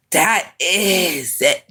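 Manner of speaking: ordinary talk, nothing marked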